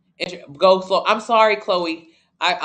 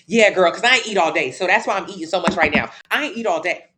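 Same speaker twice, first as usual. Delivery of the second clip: louder, defensive "I ain't eat all day"; mock defensive excuse, louder; 6 dB louder than usual. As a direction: justify the eating to anyone, loud. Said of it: "ready to tell anyone who I encounter".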